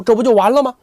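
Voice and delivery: ordinary delivery, nothing marked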